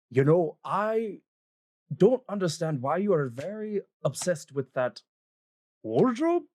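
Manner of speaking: puzzled dwarven man. Acted. Voices the dwarven husband.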